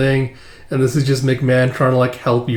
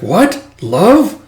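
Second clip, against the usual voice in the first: high shocked voice; 7 semitones higher, 6 dB louder than usual. Retold or imitated. Shocked character.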